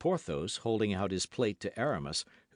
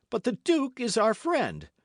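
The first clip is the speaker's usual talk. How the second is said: indignant protest; 12 semitones higher, 6 dB louder than usual. A narrator's reading. Protesting to Athos.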